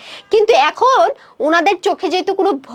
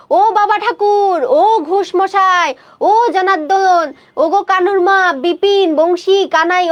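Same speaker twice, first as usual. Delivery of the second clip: long drawn-out calls, pitched up; calling out; 4 semitones higher, slower than usual. Acted. Two aunts calling neighbours by name for help, acted drawn-out holler. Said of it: "তারা দুইজনে মিলে ডাকাডাকি শুরু করলো".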